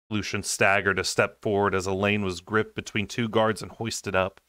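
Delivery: plain reading voice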